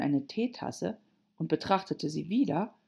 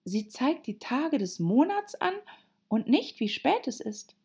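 put-on higher girl's voice; Alice, puzzled; 8 semitones higher than usual. Alice puzzling over the Hatter's watch, high and curious. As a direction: remark in puzzlement, pitched up.

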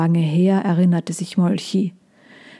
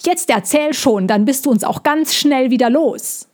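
higher, mock shout upward; the hedgehog yelling at the bird to tell the story; 6 semitones higher than usual. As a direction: bellow up at the bird. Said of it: "als er hinaufbrüllte".

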